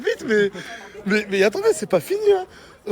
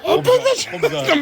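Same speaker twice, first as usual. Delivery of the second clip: much higher, laughing hype; excited; 13 semitones higher than usual. Shown as a demonstration. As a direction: shout it out with glee. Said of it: "repeats it hyped". (excité, aigu et rieur)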